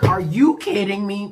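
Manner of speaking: outraged disbelief. Retold or imitated. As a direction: exclaim it in exasperated disbelief. Outraged reaction retold in story.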